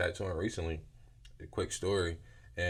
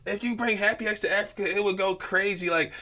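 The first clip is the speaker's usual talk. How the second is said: excited; 11 semitones higher, 6 dB louder than usual. Retold or imitated. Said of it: "And she like".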